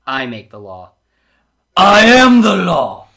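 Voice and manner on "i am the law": booming, drawn-out lawman's declaration; Judge Dredd. Acted. Reader sneaking in a movie impression, booming lawman. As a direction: bellow it slowly, high and very loud. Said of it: "had to do my quick Judge Dredd".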